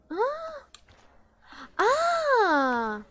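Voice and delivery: startled scream. Real, not acted. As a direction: jolt with fright at the screen. Startled by the game. (grito de susto agudo)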